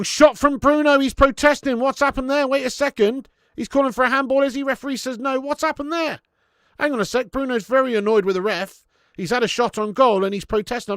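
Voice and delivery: fired-up match reaction to the ref's call; excited commentary. Real, not acted. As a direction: call the incident as it unfolds, worked up. Live reaction to a disputed shot.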